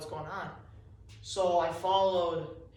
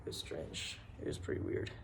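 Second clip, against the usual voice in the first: whispered aside; 10 dB quieter than usual. Real, not acted. Whispered aside about the strangeness.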